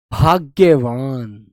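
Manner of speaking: भाग्यवान said very slowly, a doubled ग्ग pressed before य; careful pronunciation. Shown as a demonstration. Teacher shows the half letter in भाग्यवान, very slowly. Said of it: "यहां पर हलंत आना चाहिए था".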